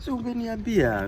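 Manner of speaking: reproachful complaint. Real, not acted. Vlogger scolding his friend for wetting the bag, whiny reproach. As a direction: complain to him sharply, pitch way up.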